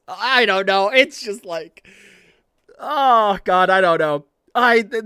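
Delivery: loud, helpless gush; overwhelmed outburst. Real, not acted. Fan overwhelmed by a favourite moment, loud gushing. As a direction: blurt it out, overwhelmed.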